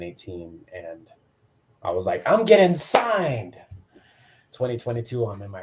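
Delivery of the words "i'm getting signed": high, drawn-out mock triumph; excited declaration. Wrestler sure his great match earns a contract, elated. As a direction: stretch it out high and louder.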